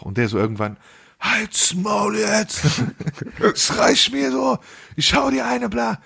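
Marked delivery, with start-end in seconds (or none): 1.2-6.0 high, slow, shouted snap-back; furious outburst. Retold or imitated. Boyfriend finally loses it at his partner, furious high yell. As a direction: explode at her, voice jumping way up. Man finally snaps at nagging girlfriend.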